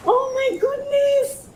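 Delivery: very high, drawn-out amazement; real overjoyed squeal. Real, not acted. Overwhelmed by her new pot, high joyful squeal. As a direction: squeal it slowly, high with joy.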